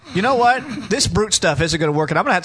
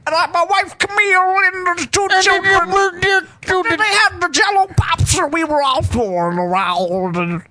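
high, slow, rambling comedian impression; Bill Cosby; 11 semitones higher than usual. Co-host riffs on old comedian's routine, high rambling impression. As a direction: wobble and stretch words as if seizing up. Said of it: "a remake of Bill Cosby's".

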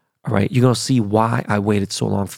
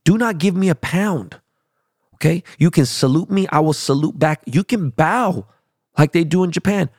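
aggressive rant; 7 semitones higher than usual. Real, not acted. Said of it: "that was a little aggressive way to start the show".

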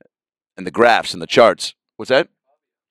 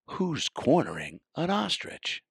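incredulous; 7 semitones higher, 10 dB quieter, slower than usual. Acted. Incredulous aside.

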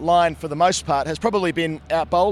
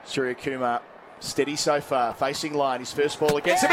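cricket commentator; 7 semitones higher than usual. Acted. Live TV wicket commentary.

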